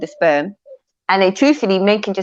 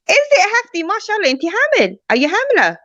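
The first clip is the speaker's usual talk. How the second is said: excited teasing; 13 semitones higher than usual. Real, not acted. Teasing pregnant question high.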